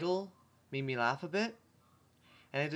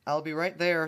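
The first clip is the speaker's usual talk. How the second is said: calling out; 4 semitones higher, 6 dB louder than usual. A narrator's reading. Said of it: "Twilight called from inside".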